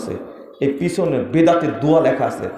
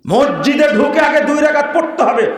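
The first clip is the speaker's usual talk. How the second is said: raised, high preaching voice; heated admonishing; 10 semitones higher, 4 dB louder than usual. Real, not acted. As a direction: lay down the rule forcefully. Heatedly lays down mosque rules. (উচ্চস্বরে কড়া নির্দেশ)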